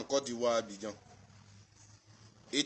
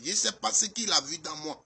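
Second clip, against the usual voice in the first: ordinary talk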